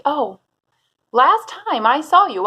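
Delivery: normal talking voice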